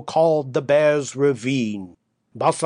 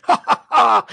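high, louder diabolical laugh; roaring laugh; 7 semitones higher, 6 dB louder than usual. Acted. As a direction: cackle loud and high like a devil. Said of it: "he roared".

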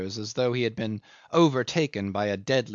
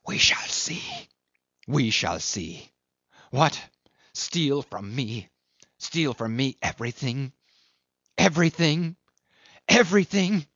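rising rage; 3 semitones higher than usual. A narrator's reading. Bitter old man rages.

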